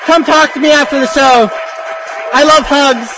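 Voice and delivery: high, loud playful call; excited shout. Real, not acted. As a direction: beam it out to the crowd. Loud playful sign-off to audience.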